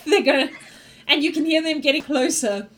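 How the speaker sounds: ordinary delivery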